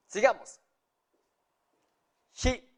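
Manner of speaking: ordinary delivery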